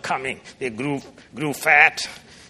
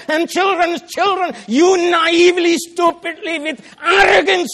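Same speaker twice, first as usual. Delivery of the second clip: high, loud preaching rebuke; impassioned rebuke; 12 semitones higher, 4 dB louder than usual. Acted. Preacher rebuking the arrogant father, loud and high. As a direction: thunder at the father who chose wrong.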